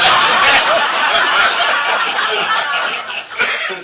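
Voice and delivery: high, breathless giggle at his own story; breathy laugh. Real, not acted. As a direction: giggle through it for real. Laughs at sex shop story.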